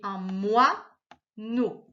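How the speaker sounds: "moineau" very slowly as moi, then neau; drawn-out "moineau", neau set apart; split syllables. Shown as a demonstration. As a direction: cut "moineau" in two with a clear gap. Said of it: "coupé ici".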